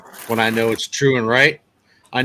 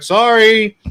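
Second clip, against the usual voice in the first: high, loud call of sorry; yelled apology; 9 semitones higher, 7 dB louder than usual. Retold or imitated. Driver yelling back to an old timer, shouted apology. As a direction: call it out as if across a lot. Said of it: "I'm yelling back to him like".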